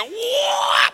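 high, drawn-out whop; swinging whop. Retold or imitated. Preacher mimics grandson swinging his toy, high whop. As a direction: imitate a wild swing with a high whoop.

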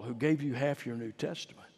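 ordinary talk

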